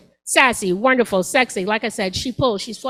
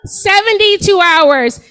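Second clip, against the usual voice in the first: louder emphatic; 7 semitones higher, 6 dB louder, slower than usual. Shown as a demonstration.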